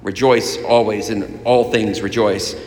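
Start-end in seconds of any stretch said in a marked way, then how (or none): none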